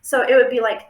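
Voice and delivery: ordinary delivery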